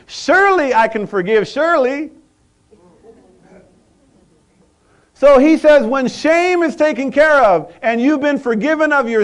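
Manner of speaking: high, loud, urgent questioning; pressing preacher. Acted. Preacher challenging congregation, loud urgent preaching. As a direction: push them for an answer.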